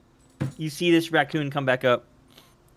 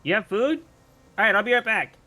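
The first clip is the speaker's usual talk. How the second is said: higher, excited critter voice; eager raccoon; 7 semitones higher than usual. Acted. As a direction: perk up at the word food. Raccoon hears of food.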